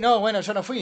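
a loud, high fake innocent "no fui"; mock innocent denial. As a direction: play the caught liar denying it loudly. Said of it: "Como para decir". (negación falsa, alta y fuerte)

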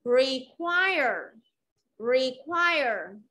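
"Require" as re-QUIRE, falling; stress on second syllable. Shown as a demonstration. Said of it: "Trọng âm rơi vào âm tiết thứ hai".

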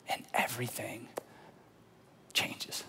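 whispered, hushed ending; dramatic whisper. Real, not acted. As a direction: drop to a whisper for the reveal. Climax of the recognition.

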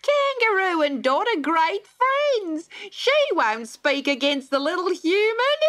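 a mocking old joker; chuckling kookaburra. A narrator's reading. Kookaburra teases the court, high and amused. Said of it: "laughed the kookaburra".

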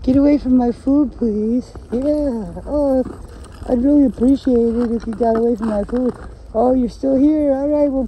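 high pleading mock-polite voice; polite dog. Imagined dog asking nicely to leave its food, high and pleading. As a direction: play the dog begging politely, fading off. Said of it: "you think they're saying it nice".

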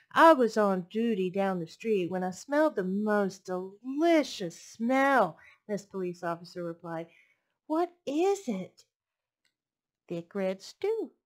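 lively storybook read-aloud; picture book read to a class; reading to children. A narrator's reading. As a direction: read it warmly to little listeners.

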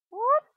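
high, loud whoop; sudden high cheer; excited whoop. Real, not acted. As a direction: let out a short, piercing whoop. Cheering during the game.

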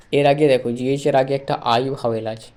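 ordinary talk, nothing marked

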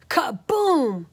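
big drawn-out kaboom; loud, slow exclamation; storybook crash. A narrator's reading. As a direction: boom it out slowly for the crash. Sound effect of chair breaking.

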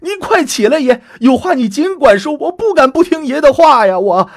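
high, loud panicked urging; alarmed. Acted. Startled grandson pulling his kneeling grandfather up, high and panicked. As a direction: rush to lift him, frightened. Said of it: "大惊失色".

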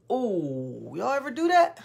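normal talking voice

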